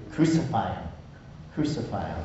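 usual speaking voice

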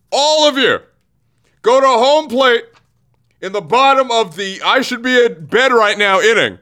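exasperated rant. Real, not acted. Host scolding Angels hitters for losing, exasperated. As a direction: berate the whole lineup.

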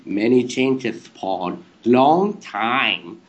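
put-on very slow, lisped German-accented gibberish voice; weird German accent. Statue baffling Paul on air, drawn-out weird German accent. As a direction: drag out each word in a silly German accent. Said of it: "like, in this weird voice".

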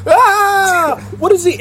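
high, loud fangirl squeal; excited shriek. Retold or imitated. Fans squealing when DeCarlo messages them, high and loud. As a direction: squeal as if your idol just messaged you. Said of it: "we go".

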